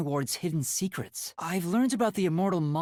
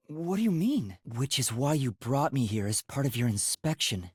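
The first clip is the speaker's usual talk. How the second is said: ordinary talk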